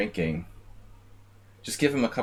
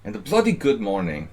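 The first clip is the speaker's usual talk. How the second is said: put-on amateur British accent, more restrained; mock British accent; 4 dB louder than usual. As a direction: try the British accent again, less loud. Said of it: "my British accent needs a lot of work".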